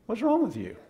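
high, light voice coaxing; gentle usher voice. Retold or imitated. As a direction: invite them in kindly. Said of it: "we'd come out there and say".

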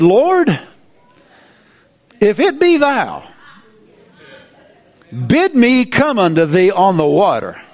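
a bold, pleading call to the Lord; Peter calling out. Acted. Peter calls to Jesus.

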